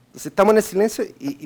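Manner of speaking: usual speaking voice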